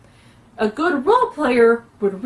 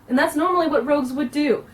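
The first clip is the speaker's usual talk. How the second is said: ordinary talk, nothing marked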